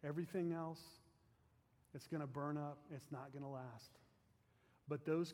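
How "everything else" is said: ordinary talk, nothing marked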